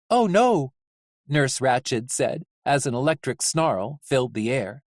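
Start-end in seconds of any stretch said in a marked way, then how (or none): 0.1-0.7 high, loud deadpan dismay; high-pitched nurse's brief alarm. Acted. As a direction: say it high and louder, evenly. Nurse Ratched facing the shock.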